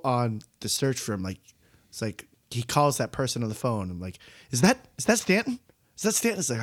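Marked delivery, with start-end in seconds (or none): 4.5-6.4 eager excitement. Retold or imitated. Eagerly spotting an actor on screen.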